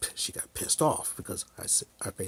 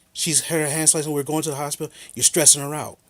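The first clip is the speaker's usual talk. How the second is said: raised, louder voice of an accusing man; retold angry accusation; 4 semitones higher, 6 dB louder than usual. The dude grabbing the phone in a fight, angry. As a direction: re-enact his heated phone outburst. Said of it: "we got in this big fight".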